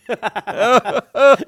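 loud high burst of laughter; laughing. A narrator's reading. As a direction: break out laughing along. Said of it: "En ik begon mee te lachen".

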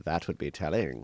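low, very soft villain reply; cagey interrogator. Acted. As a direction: drop low and quiet, withholding the answer. Answers as the show's interrogator.

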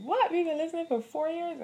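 high-pitched incredulous listener; mock disbelief. Podcaster imitating listeners shocked at four years, high mock disbelief. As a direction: blurt it high, as if astonished. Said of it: "some of you might be like".

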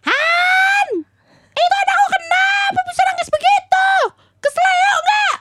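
very high, loud shout; imitated yelling. Relative downstairs calling up about the crying child, shrill yelling. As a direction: holler shrilly from another floor. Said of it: "orang bawah tinggal teriak".

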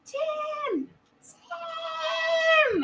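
high, drawn-out mock shout; distant calling. Missy running down the pier to Tim, calling out. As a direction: call his name from far down the pier.

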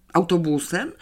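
plain reading voice

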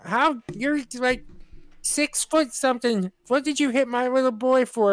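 indignant and high-pitched; outraged mother. Retold or imitated. Acting out the outraged mom.

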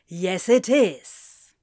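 slow, loud insistence; emphatic, drawn-out comeback; firm contradiction. A narrator's reading. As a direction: bicker back, heavy on each word. Acted bickering between two friends.

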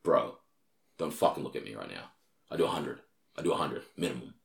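low, understated tough-guy rebuke; dismissive put-down. Retold or imitated. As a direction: drop low and quiet, unimpressed with a complainer. Acted put-down of lazy workers.

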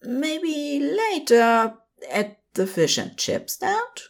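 gently scold, then preen; fussy Aziraphale. A narrator's reading. Aziraphale brushing off Crowley's self-criticism, high and prim.

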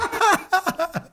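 very high-pitched giggle; laugh. Real, not acted. Guest hears his childhood nickname, laughing high.

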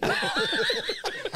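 high squealing laugh; burst of laughter. Real, not acted. Laughing at the punchline of the chicken story.

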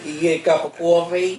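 mock Boston accent. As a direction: imitate a bad Boston line read. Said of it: "the worst Boston accent".

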